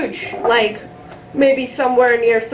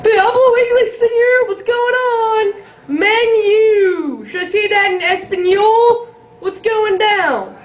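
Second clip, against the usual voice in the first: mock rant; 7 semitones higher, slower than usual. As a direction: yell for the menu like a buffoon. Customer ranting for menu.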